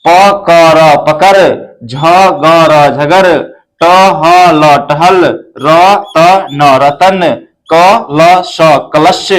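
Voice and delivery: each letter sounded out slowly, then the whole word; slow spelling-out. Shown as a demonstration. Teacher spelling words for pupils, slow and deliberate. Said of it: "ये नाम जो है यहीं से बना हुआ है".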